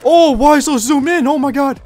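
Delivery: slow build to a high, loud wail; panicked yelling. Real, not acted. Player hating the zoomed-in scope, panicked shouting. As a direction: yell in real distress, stretching the words.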